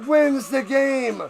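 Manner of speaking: loud, high, drawn-out game-winning call; ecstatic play-by-play. Real, not acted. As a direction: shout the walk-off victory to the fans. Live play-by-play celebrating winning kick.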